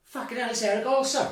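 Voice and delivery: high-pitched, starstruck blurt, re-enacted; retold amazed exclamation. Man spotting a famous fighter outside the toilet, amazed.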